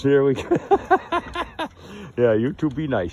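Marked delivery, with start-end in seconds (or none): 0.5-2.1 high falling giggle. Real, not acted. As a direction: giggle high and let it fall. Laughing at goofballs.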